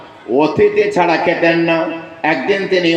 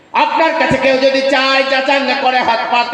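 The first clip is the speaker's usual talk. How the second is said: raised, higher ringing exhortation; preaching at full force; 8 semitones higher than usual. Acted. Preacher urging listeners to give to beggars, raised exhortation. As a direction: lift the voice and urge the crowd.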